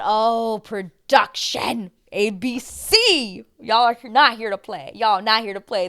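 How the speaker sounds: loud, drawn-out address to the producers; impressed call-out. Retold or imitated. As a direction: stretch out each word in mock admiration. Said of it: "this was the moment I said".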